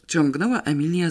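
plain reading voice

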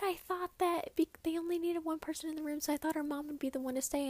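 husband quoted. Retold or imitated. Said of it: "And he was like".

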